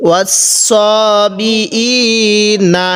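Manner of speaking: very slow, drawn-out recitation; recited demo. Shown as a demonstration. Teacher showing the reading, slow chanted recitation. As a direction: stretch ওয়াসবিইনা slowly, the way it is recited. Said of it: "এরকমভাবে পড়ব।".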